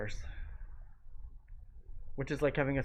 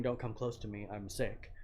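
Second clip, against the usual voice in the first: quiet warning; 5 semitones lower, 11 dB quieter than usual. Retold or imitated. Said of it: "It's just like saying".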